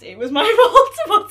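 laughing through the words. Real, not acted. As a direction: giggle out the admission. Laughing admission.

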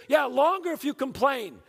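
high, loud comeback; angry dad threat. Acted. Dad threatening his complaining son, angry loud shout. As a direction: double down at full volume.

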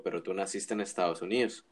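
usual speaking voice

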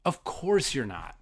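ordinary delivery

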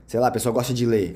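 ordinary talk

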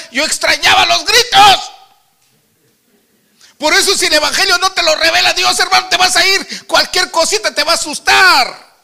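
loud fervent preaching. Acted. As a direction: drive the message home to the congregation. Preaches at full force. (predicación fervorosa y fuerte)

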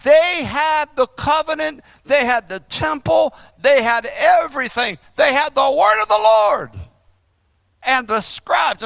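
mildly heated, high sermon reproof; sharp rebuke. Acted. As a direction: hammer each point as a reproach. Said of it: "He's rebuking them sharply".

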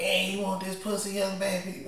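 raspy, laughing proposition; a crackhead woman voicing her offer. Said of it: "the women sound just like the men".

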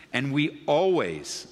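always drawn out slowly, leaned on hard; stress on always. Shown as a demonstration. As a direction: press down on always and take your time. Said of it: "I emphasize the word always".

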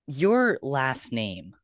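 ordinary talk

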